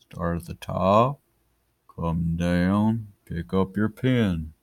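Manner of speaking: slow, drawn-out step-by-step instructions. Shown as a demonstration. Teacher showing how to write capital H, slow-mo. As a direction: stretch each word out in slow motion. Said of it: "I'll do slow-mo".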